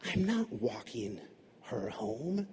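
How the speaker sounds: low, very soft, slow objection; sullen refusal. Acted. Child objecting to Dad's plan, sullen quiet refusal. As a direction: refuse under your breath, slowly.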